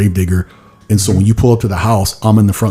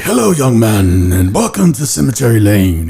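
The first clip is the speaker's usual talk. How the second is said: slow, drawn-out early American welcome; put-on early American conductor's character accent. Shown as a demonstration. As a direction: greet guests in a stretched old-time American accent. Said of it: "like, an early American accent".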